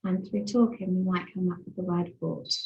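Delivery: normal talking voice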